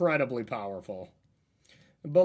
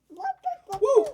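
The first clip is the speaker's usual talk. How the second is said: dad's high cheer for his daughter; cheering woo; 4 dB louder than usual. Real, not acted. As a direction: whoop her on. Cheering toddler's clicking.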